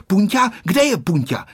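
put-on high, eager child's question; eager little boy. A narrator's reading. Honzík looking for his loyal friend, eager boy's voice. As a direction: ask high and keenly, like a searching child. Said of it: "scháněl se Honzík".

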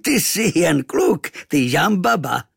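high, loud, drawn-out exotic figure; booming amused native. A narrator's reading. Tattooed stranger finds it is only a boy, amused. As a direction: crow with mocking amusement. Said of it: "rozchechtal se".

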